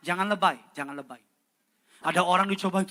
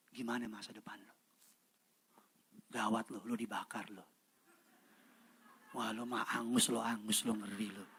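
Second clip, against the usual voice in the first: low, soft mocking jeer; sly teasing threat; quiet taunt; 6 semitones lower, 7 dB quieter than usual. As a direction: roast the devil gleefully. Taunting the devil back.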